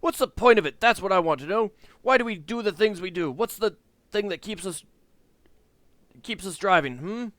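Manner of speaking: high, loud, rising rant; gathering steam. Acted. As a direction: build into a heated rant. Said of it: "The voice pauses and then gathers steam".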